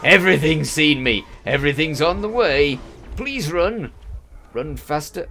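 frantic urging while fleeing; panicked, urging yourself to run; being chased. Real, not acted. Something's seen me.

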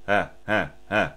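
breathy "Huh" pushes from the belly; breath accents in one air stream; diaphragm pulses. Shown as a demonstration. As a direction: puff each note from the diaphragm. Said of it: "they're going".

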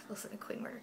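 a secret whisper; whispered aside. Real, not acted. Whispering a jab about the roommate nearby. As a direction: whisper it so she can't hear. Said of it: "whispered aside about roommate".

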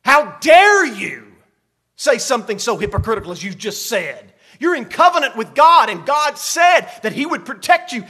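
loud, incredulous prophetic scolding; shouted rebuke. Acted. Isaiah berating Ahaz for his hypocrisy, shouting. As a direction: hurl the accusation, punching key words loudly. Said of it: "he shouts at him and he says".

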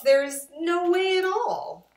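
high, loud wail of despair; the fish cries out hopelessly. Acted. Fish wails to the children about the mess.

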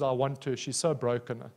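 low, soft and tender, as God speaking; gentle divine voice pleading over a broken singer; voice of the Holy Spirit. Acted. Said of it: "And the Holy Spirit says".

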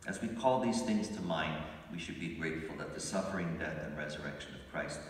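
ordinary talk

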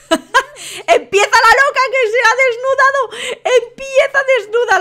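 high, amused shrieky exclaiming; scandalised outburst. Real, not acted. Host sees the crazy woman undressed, scandalised outburst. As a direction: cry out in delighted shock.

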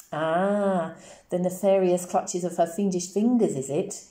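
policeman savouring her dramatic words; amused mock-spooky repeat. A narrator's reading. Policeman mocks the child's words.

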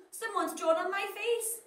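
high, indignant vegetable voice; broccoli upset about its face. Acted. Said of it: "cried the broccoli".

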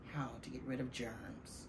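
ordinary delivery, nothing marked

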